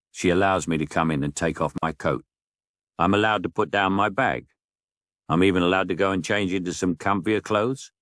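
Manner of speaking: ordinary delivery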